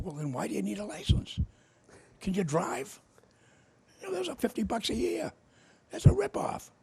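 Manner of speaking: high, loud, needling rhetorical questions; mock-incredulous taunting. Mocking a radio host in a debate, high and loud. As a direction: pitch up and goad him like a debater.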